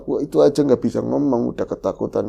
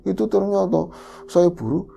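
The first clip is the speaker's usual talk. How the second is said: normal talking voice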